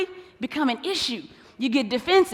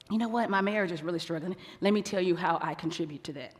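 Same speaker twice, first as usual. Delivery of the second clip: honest confession; 6 semitones lower, 10 dB quieter than usual. Retold or imitated. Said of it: "this is what I don't hear".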